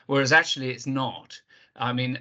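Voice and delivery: ordinary delivery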